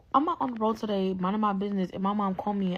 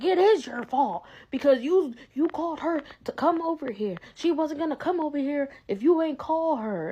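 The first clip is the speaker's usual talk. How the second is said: high, insistent imitation of mom; mom with attitude; 7 semitones higher than usual. Mom blaming daughter for sister coming over, mock attitude. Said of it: "she got an attitude with me".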